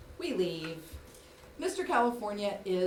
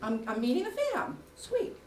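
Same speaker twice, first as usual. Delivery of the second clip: giddy excitement; 9 semitones higher than usual. Retold or imitated. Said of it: "And I'm thinking".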